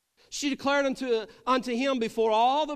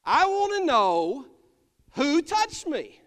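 higher, louder, slow and firm; Jesus insisting; 5 semitones higher, 5 dB louder than usual. Acted. Jesus answers the frightened woman, firm and slow. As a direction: lay down each word with authority.

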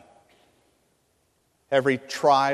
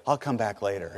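awkward retreat; 5 semitones lower, 6 dB quieter than usual. Retold or imitated. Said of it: "and he goes".

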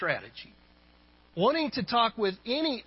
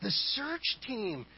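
high, soft, wonder-struck little voice; awe-inspired child; 5 semitones higher, 6 dB quieter than usual. Acted. Child reporting the helicopter landing to the boss, awestruck. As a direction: imitate a child hushed with awe. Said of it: "In an awe-inspired voice".

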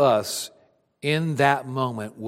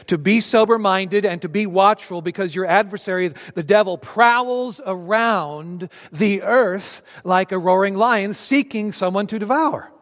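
louder, higher preaching of the verse; scripture read with force; 6 semitones higher, 4 dB louder than usual. Acted. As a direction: proclaim the verse to the congregation.

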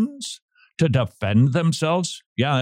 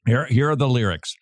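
ordinary talk, nothing marked

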